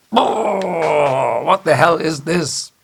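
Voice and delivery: high, loud disgusted cry; shocked old engineer's outcry; outraged Scotty. Retold or imitated. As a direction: spit out the fake whisky in horror. Scotty tasting synthetic Scotch.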